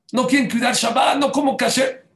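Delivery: father voicing his children's defiance, high and loud; defiant children. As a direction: throw it out like a defiant teen. Voicing rebellious children's complaints.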